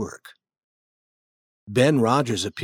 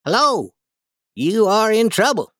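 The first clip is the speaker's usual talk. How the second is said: higher, louder taunt; teasing boy; 10 semitones higher, 5 dB louder than usual. Acted. Ben teases Tom at the fence, higher and louder. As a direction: needle Tom about working.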